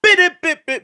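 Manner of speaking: high loud mouth horn riff; horn imitation. Shown as a demonstration. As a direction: blare it like a horn. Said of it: "It needs something like that".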